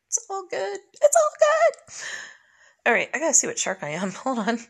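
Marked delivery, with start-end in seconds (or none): 0.1-1.9 building reassurance. Real, not acted.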